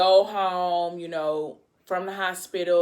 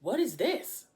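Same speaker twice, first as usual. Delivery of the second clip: a hint of a startled man, pitched up; mock shock; 6 semitones higher, 3 dB quieter, slower than usual. Man watching the tape of his girlfriend, mildly shocked. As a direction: ask it in mild disbelief. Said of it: "And he like".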